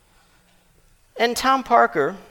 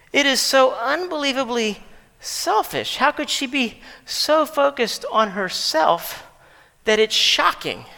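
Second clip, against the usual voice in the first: ordinary delivery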